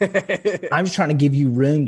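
slow, laughing through the words. Real, not acted. Joking to the host, laughing while talking. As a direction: chuckle it out as a joke to the host. Joking aside after Danielle's retort.

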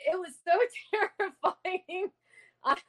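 high-pitched words through real laughter; laughing. Real, not acted. Ghost hunter recalling a terrifying recording, laughing. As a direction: laugh helplessly through the phrase.

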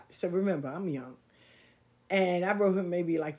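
ordinary delivery

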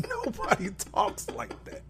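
high, laughing scoff; laughing disbelief. Retold or imitated. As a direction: crack up in high-pitched disbelief. Laughing at wrestler's voice.

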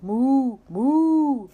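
drawn-out storybook moo; cow mooing. A narrator's reading. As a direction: moo it out like a picture-book cow. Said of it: "said Cow".

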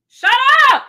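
high, loud shout; real exasperated yell. Real, not acted. Parent interrupting the podcast at a loud child, real yell. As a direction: yell to silence the racket. Yells at noisy child mid-recording.

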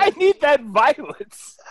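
high, slow, laughing craving; mock thrill craving. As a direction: savour the word violence. Echoing with mock relish.

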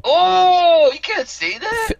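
high, loud shocked gasp of protest; co-host shocked at the swear on air; shocked objection. Real, not acted. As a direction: gasp as if he crossed a line.

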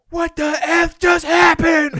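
imitating a stunned fan's high, loud scream; mock meltdown at the TV; outraged disbelief. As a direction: stretch it out in a slow furious holler. Acting out fan shocked by play.

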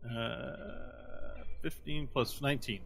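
ordinary talk, nothing marked

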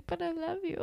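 high, small pleading voice; mock lovesick plea. Fan playfully pleads her love for Alex, mock lovesick. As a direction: squeak it out like a smitten fan.

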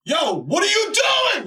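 high, slow, drawn-out yell at the TV; slow, high disbelieving shout; exasperated yell. Retold or imitated. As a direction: holler it slowly, like the shot makes no sense. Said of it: "I was like".

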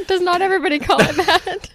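asking through real laughter; laughing. Real, not acted. Laughing at friend's teasing.

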